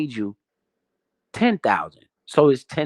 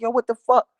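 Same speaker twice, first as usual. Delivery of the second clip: imitating stunned friends; 9 semitones higher than usual. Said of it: "they're like".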